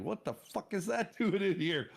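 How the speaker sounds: high-pitched retold outburst; incredulous, laughing through the words; shocked disbelief. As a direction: act out a startled discovery. Retold shock at finding panther.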